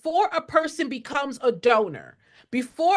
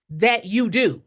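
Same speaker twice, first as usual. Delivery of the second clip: normal talking voice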